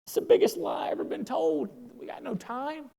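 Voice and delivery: high-pitched mock outrage at Tim; excited protest. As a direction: squeal it like you can't wait another day. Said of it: "I was like".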